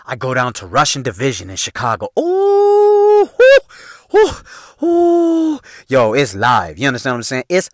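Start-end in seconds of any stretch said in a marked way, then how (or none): 2.2-5.6 high, loud hype whoops; party whoop. Real, not acted. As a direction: whoop like the club is live. Hyping Rush and Division nightlife.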